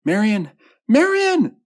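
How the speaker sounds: high loud calls, rising to a scream; escalating alarmed shrieks; crying out. A narrator's reading. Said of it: "Louise cried out".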